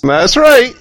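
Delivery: high, loud catchline yell; mock hype shout. Co-host answering the radio plug, mock hype shout.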